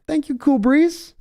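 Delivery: put-on voice. Streamer thanks chatter in put-on voice.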